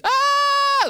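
high, loud, shrill-pitched mock scream; little-girl scream. Preacher mimics himself thrown in the furnace, screaming. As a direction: shriek like a scared little girl. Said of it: "Like a little girl".

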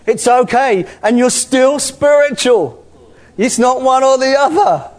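joyful preaching climax; excited joy. Real, not acted. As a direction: let the delight burst out.

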